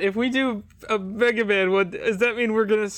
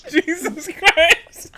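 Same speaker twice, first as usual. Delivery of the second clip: laughing; 8 semitones higher, 5 dB louder, much slower than usual. Real, not acted.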